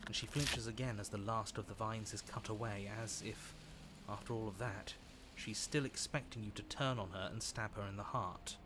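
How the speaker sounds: low, quiet storytelling voice; story narration. A narrator's reading. Narrates the rescue scene.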